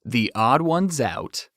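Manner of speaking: slow, pointed title; slow deadpan The Odd Ones Out; deadpan reveal. Shown as a demonstration. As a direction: land the name slowly for the joke. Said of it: "get this".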